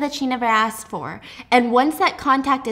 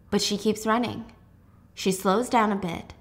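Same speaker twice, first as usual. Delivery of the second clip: ordinary delivery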